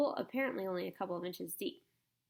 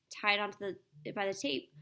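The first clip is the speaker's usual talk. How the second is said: ordinary delivery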